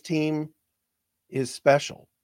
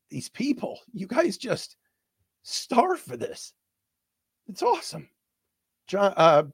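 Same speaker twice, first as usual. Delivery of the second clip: choked up; 6 semitones higher than usual. Real, not acted. Host moved by fans who starve for this, choked up. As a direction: let the words catch in your throat. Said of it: "I'm emotional about it".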